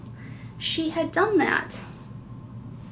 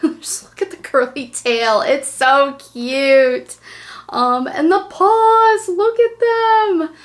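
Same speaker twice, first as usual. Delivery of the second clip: put-on baby talk with a lisp; 2 semitones higher, 7 dB louder than usual. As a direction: coo at the dog with a lisp. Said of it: "we always talk to him with a lisp".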